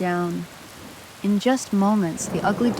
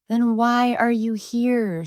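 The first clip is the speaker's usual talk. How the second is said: slow, higher sneer; 5 semitones higher, slower than usual. A narrator's reading. Tom cat sneering down at the duckling, slow and scornful. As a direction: drag it out with contempt. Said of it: "sneered the tom cat".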